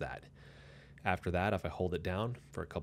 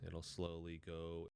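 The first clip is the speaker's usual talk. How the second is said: gain turned down; 12 dB quieter, slower than usual. Shown as a demonstration. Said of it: "as I turn it back down".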